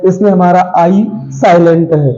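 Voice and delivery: ordinary talk, nothing marked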